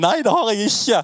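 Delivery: put-on boy denying. Boy denies stealing to the angry kiosk owner, panicked high protest. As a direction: protest your innocence like a scared kid.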